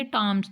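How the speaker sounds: टाम्स with a hard T and long a; said Tams. Shown as a demonstration. Teacher answering her own question for class, clear T. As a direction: say टाम्स, not थॉमस. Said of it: "थॉमस नहीं इसको बोलेंगे".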